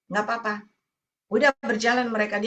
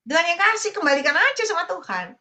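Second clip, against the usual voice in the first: her own advice retold, pitched much higher and pressed home; emphatic advice; 8 semitones higher than usual. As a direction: urge her to give it back to God. Preacher retelling her own advice. (nasihat yang ditekankan, nada tinggi)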